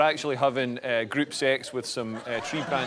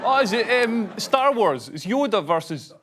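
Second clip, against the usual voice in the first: excited guessing; 7 semitones higher, 4 dB louder than usual. Real, not acted. Frantic guessing in charades.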